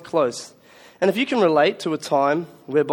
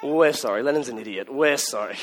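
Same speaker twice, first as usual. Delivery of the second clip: soothing mum; 5 semitones lower, 5 dB quieter than usual. Retold or imitated. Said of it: "Mum's like".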